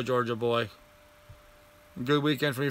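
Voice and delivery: ordinary talk, nothing marked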